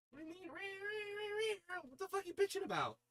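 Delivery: squeaky, fast mock outrage at the cat; high mock-indignant cat talk. Real, not acted. Answering the meowing cat in high mock outrage. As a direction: scold the cat up high, half joking.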